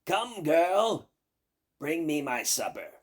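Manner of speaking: loud, high witch's call; shouting to the kitchen. Acted. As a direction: bellow for supper across the house. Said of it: "shouting loudly to the kitchen".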